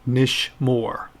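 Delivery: "Nish Moore" slowly, in two beats; pronounced for repetition. Shown as a demonstration. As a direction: model "Nish Moore" with separated syllables. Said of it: "pronounce them after me".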